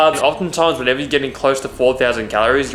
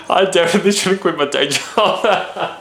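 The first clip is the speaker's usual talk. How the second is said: higher, laughing through the words; 6 semitones higher than usual. Real, not acted. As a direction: crack up at your own bad impression. Laughs at own bad impressions.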